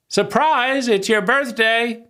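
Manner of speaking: high, bright mock "Surprise!" at a party; party surprise shout. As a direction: yell it like friends jumping out at a birthday. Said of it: "we say".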